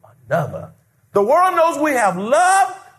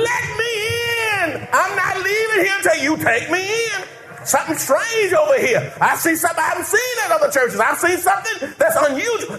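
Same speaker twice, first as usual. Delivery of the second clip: pleading shout; 9 semitones higher than usual. Retold or imitated. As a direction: shout like a sinner desperate to enter. Sinner pleading at the door.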